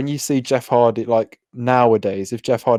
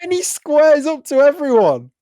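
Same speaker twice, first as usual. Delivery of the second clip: very high, incredulous gushing; amazed admiration; 3 dB louder than usual. Real, not acted. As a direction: pitch right up in delighted disbelief. Gushing over a non-wrestler's nerve.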